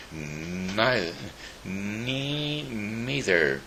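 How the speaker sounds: faltering, tiny mouse voice; tiny little voice. Acted. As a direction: squeak it out like a timid mouse. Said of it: "said the tiny little voice".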